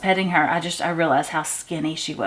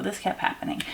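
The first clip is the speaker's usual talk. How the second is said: whispered repeat; 9 dB quieter, faster than usual. Real, not acted.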